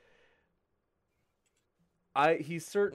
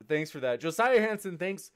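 usual speaking voice

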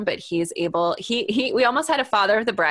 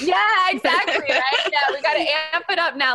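laughing excitedly; 7 semitones higher, faster than usual. Real, not acted. Excited retelling, laughing at militant idea.

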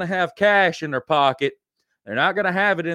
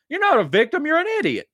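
high-pitched outburst; 11 semitones higher, faster than usual. Acted. Ranting at drug-deal shooting victims.

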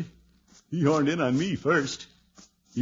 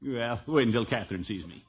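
usual speaking voice

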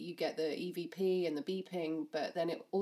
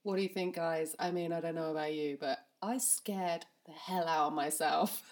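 ordinary delivery

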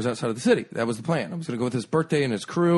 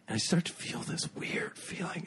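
much softer, whispered and eerie; ominous whisper; 5 semitones higher, 11 dB quieter than usual. Acted. Telling friends the sickness is coming, in a whisper. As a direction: hush it like a scary story. Ominous build to food poisoning.

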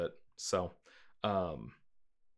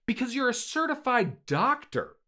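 loud, high-pitched scolding outburst; exasperated dad; 12 semitones higher, 6 dB louder than usual. Acted. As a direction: berate your son in incredulous, raised voice. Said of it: "If his dad had just been like".

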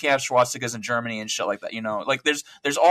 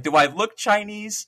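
mock offended Korean; 6 semitones higher, slower than usual. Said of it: "calls a Korean Chinese".

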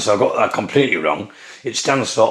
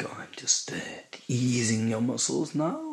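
ASMR masseur; 11 dB quieter than usual. Acted. Masseur working on a client's muscles, soft ASMR voice. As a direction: ease the words out quietly and intimately. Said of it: "I'm doing the same voice".

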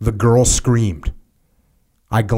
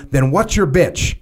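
higher-pitched, pressing officer's demand; angry superior; 5 semitones higher, slower than usual. A narrator's reading. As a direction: read the colonel's anger pitched up. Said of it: "immediately began screaming".

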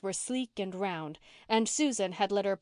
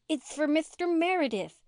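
put-on higher, lisping little girl voice; 8 semitones higher than usual. A narrator's reading. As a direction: lisp it sweetly like a small girl. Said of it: "she lisped".

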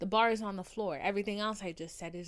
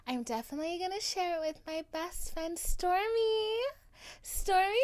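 Kylie; 8 semitones higher, 6 dB louder than usual. Acted. Podcast host as Kylie plugging the show to Stormy, Kylie impression. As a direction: stretch the words, climbing into a high coo. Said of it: "This is Kylie".

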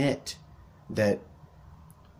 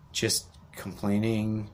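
ordinary delivery

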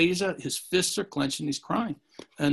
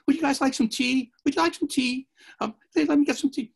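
put-on sweet old host; 11 semitones higher, 3 dB louder than usual. O Sensei offering tea sweetly.

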